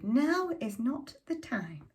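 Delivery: ordinary delivery, nothing marked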